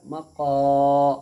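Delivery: मक़ा with a loud, long held क़ा; stretched alif madd. Shown as a demonstration. Teacher joining the syllables, sustained final vowel. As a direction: hold the end of मक़ा, full and round. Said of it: "खींच करके अदा करेंगे".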